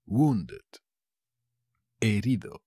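normal talking voice

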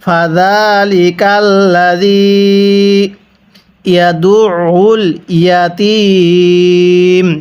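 ইয়াতীম held three alifs long at the pause; three-alif stretch. Shown as a demonstration. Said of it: "হ্যাঁ, আমার সাথে পড়ুন". (ইয়াতীম তিন আলিফ টেনে)

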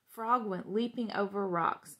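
usual speaking voice

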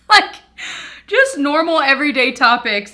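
high, loud, laughing disbelief; amused exclaiming through a laugh; incredulous laughing. Real, not acted. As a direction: burst out laughing, then carry on loudly. Amused by doctor's casual chatter.

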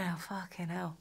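put-on English accent, soft and laughing. Guest playing along with favourite English word, in an English accent. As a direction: swear softly in a playful English accent. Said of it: "favourite word in an English accent".

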